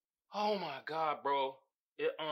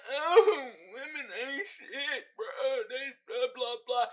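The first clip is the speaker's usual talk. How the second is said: mock hater; 5 semitones higher, slower than usual. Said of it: "Where I could be like".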